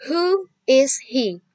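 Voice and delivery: a teacher modelling a question for the class; slow model reading. Shown as a demonstration. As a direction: pronounce it word by word. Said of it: "Lanjut ya".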